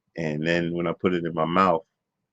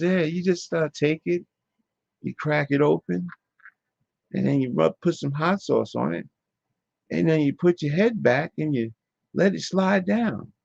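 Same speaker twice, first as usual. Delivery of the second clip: put-on high-pitched little kid voice; put-on child's voice, pitched way up; young kid; 10 semitones higher than usual. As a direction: explain it like a kid showing a grown-up how. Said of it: "So I said, "Young kid".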